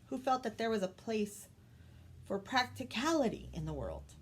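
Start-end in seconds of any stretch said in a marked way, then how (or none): none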